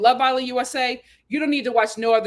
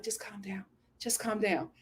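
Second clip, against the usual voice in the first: soft soothing; 5 semitones lower, 13 dB quieter than usual. Retold or imitated. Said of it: "Serena was like".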